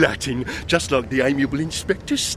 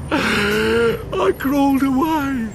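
tearful; 7 semitones higher, much slower than usual. Retold or imitated. End of the flashback.

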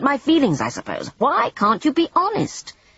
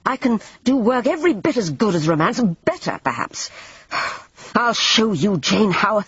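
higher, louder defiant anger; angrily; 5 semitones higher, 4 dB louder than usual. A narrator's reading. Alan vows to Jane he can still paint, angry. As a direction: throw the challenge back at her. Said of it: "he said angrily".

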